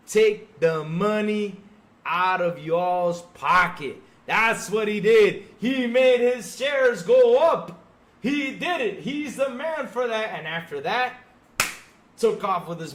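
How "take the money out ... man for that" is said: sarcastic loud praise. Real, not acted.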